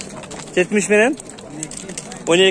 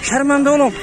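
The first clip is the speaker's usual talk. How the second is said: higher, imitating a flustered man begging not to be filmed; higher mock plea, don't film me; mock pleading; 8 semitones higher than usual. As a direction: act out a seller afraid of being shamed on camera. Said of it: "деп түшүнтүп калышат адамдар".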